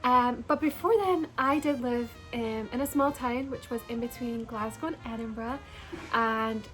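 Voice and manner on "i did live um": normal talking voice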